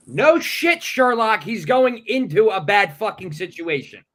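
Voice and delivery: loud, high sarcastic rant; exasperated outburst. Real, not acted. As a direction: blow up at the obvious stupidity. Exasperated rant at quoted father.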